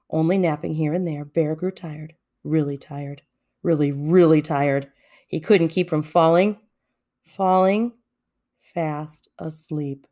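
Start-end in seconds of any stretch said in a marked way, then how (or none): none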